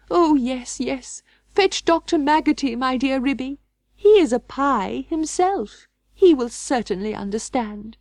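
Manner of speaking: high, urgent pleading; anxious Duchess. Acted. Duchess urging Ribby to fetch the doctor, high and pleading. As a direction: beg her to fetch help.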